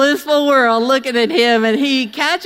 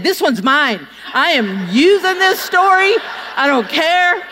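defiant declaration; 5 semitones higher than usual. Retold or imitated. Claiming the story.

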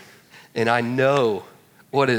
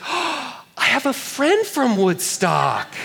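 put-on much higher, slow excited girl voice; imitating the gal; 11 semitones higher, 3 dB louder, much slower than usual. College girl excitedly finds a connection, high and slow. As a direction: gush it slowly like a delighted coed. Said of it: "she's like".

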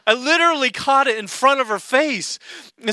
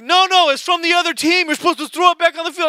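higher, fast nagging voice; insistent fans; 7 semitones higher, faster than usual. Retold or imitated. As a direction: pester him about the rules.

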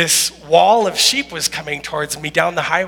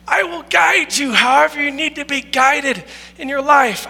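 preaching; 5 semitones higher than usual. Acted. Preaches God's promise as quote.

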